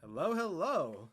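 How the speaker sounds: ordinary talk, nothing marked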